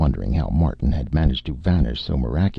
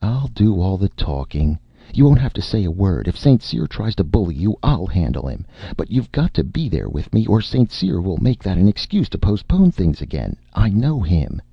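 higher, emphatic reassurance and orders; firm agent Erika, insistent; 7 semitones higher, 4 dB louder than usual. A narrator's reading. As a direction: override objections with a lifted, forceful voice. Erika overrides Martin.

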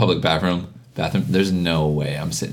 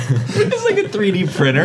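laughing; 12 semitones higher, slower than usual. Real, not acted. Joking while laughing.

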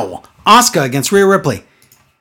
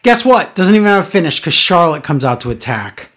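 normal talking voice

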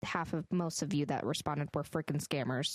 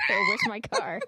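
laughing; 6 dB louder than usual. Real, not acted. Punchline of the intro bit.

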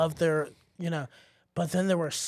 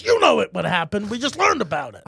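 loud, higher retort from an irritated teacher; angry teacher; 4 semitones higher, 7 dB louder than usual. Retold or imitated. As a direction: snap it back, loud and raised. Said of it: "And he got mad".

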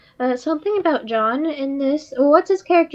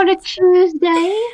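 hammed-up British accent, higher; mock British accent; 5 semitones higher, 4 dB louder than usual. Keeping the joke going in a mock British accent. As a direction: tease in a silly British voice.

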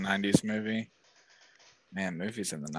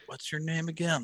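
acted question; 8 semitones higher, 4 dB quieter than usual. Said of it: "He's like".

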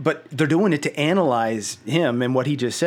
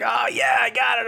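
cocky shout; 10 semitones higher than usual. Retold or imitated. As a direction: shout it like a cocky hero grabbing the job. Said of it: "Strafe is like".

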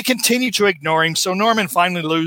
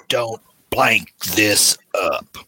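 menacing threat; 10 semitones lower, much slower than usual. Retold or imitated. Kingpin's threat to Norman.

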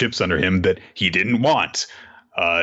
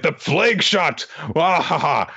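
villain boast; 6 semitones higher, slower than usual. Retold or imitated.

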